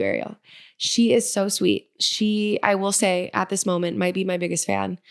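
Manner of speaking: ordinary talk, nothing marked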